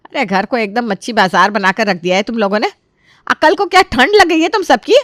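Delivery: loud scolding mother silencing the house; furious, forceful telling-off, slowing at the end; angry shouting. Acted. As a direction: shout them all down. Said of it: "Kiran जी बहुत जोर से चिल्लाती है".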